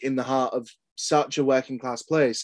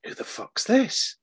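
baffled; 11 semitones higher, slower than usual. Retold or imitated. Confused reaction to email, retold.

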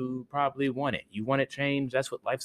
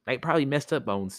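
ordinary delivery, nothing marked

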